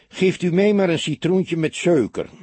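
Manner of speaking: put-on high, loud North Holland accent. A narrator's reading. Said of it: "met een onvervals Noord-Hollands accent". (onvervalst Noord-Hollands accent)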